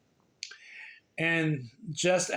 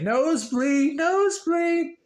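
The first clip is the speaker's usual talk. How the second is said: much higher squeak of nosebleed, nosebleed; silly high voice; 12 semitones higher, 4 dB louder than usual. Pretend. Goofing off mid-reading for viewers, high cartoon voice. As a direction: pitch it way up like a cartoon.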